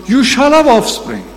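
high, loud proclamation of the promise; God declares it to the childless Abraham, ringing out; God's promise. Acted. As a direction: announce it like God himself. Preacher voices God's promise.

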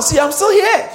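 high, loud triumphant boast; excited declaration. Shown as a demonstration. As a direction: proclaim it triumphantly. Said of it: "Tell someone".

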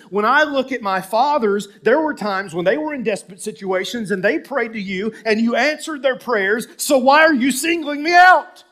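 high, loud outcry building to a shout; preacher acting a man left behind; escalating protest. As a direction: build from argument to indignant yelling. Preacher voices David's escalating protest.

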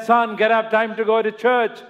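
lightly voiced mother; a hint of a mother calling. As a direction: call him up for church.

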